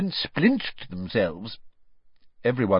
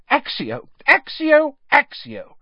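shouted spells, louder; 8 dB louder, slower than usual. A narrator's reading. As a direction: bark each spell out. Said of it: "she shouted".

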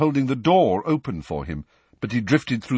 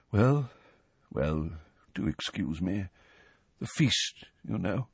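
awkward soft; 6 dB quieter than usual. Acted. Nick excusing himself.

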